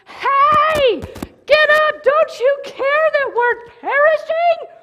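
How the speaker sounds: very loud, high yell at sleeping Jesus; furious wake-up demand; shouting over the storm; angry shout. Retold or imitated. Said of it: "no, he's like".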